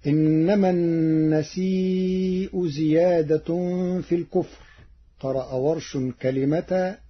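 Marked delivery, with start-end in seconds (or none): 0.1-4.7 Quran recitation. Acted. Reciter chanting the verse before explaining its reading.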